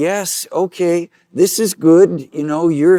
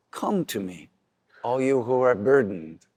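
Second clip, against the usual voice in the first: much softer and lower, gentle welcome; soothing call to the weary; tender invitation; 3 semitones lower, 4 dB quieter than usual. Shown as a demonstration. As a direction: say Come to me almost hushed, inviting. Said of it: "Jesus is just as loving".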